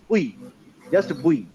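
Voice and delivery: "বুই" said short, with no held glide; no lin stretch. Shown as a demonstration. Teacher showing the exception, "বুই" kept short. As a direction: keep "বুই" brief and unstretched. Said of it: "বুয়ের বেলায় কিন্তু লিন হবে না".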